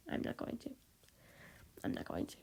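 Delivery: whispering. Real, not acted. Repeating promise to self.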